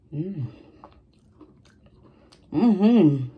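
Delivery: humming while chewing; murmuring through food; mouth full chewing. Real, not acted. Seen 3: Spoon goes in at -1; closed mouth keeps chewing through the mm sounds.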